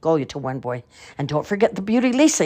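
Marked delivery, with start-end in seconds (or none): 1.2-2.5 calling out. A narrator's reading. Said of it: "he called to still another boy".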